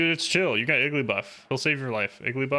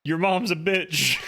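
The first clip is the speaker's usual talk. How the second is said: higher, cocky taunt at the monster; higher mock bravado yell; taunting dub voice; 6 semitones higher than usual. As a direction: call out the monster like a brat. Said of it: "he's talking smack".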